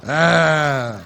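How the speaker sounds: loud put-on moan of pleasure; a comic, overdone "ah" of pleasure; mock sex moan. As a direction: ham up a loud bedroom moan. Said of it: "Whatever noise they make".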